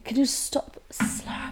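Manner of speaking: whispered, slow aside; whispering. Real, not acted. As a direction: hiss it under your breath. Hushed aside to co-host.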